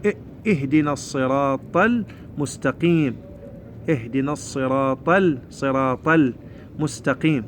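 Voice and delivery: slow recitation, اهدنا opening on a clear "i"; measured model from اهدنا onward; hamza cut. Shown as a demonstration. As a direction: recite in parts, sounding the L before المستقيم. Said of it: "نسوي همزة قطع".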